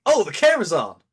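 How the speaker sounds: high, loud mock streamer voice; hammed-up delighted streamer; DSP impression. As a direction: squeal it like a streamer spotting his camera. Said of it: "and be like".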